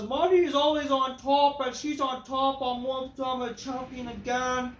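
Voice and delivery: a loud, high mock brag; imitating Rhea Ripley. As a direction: boast like a cocky champion. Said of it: "She says".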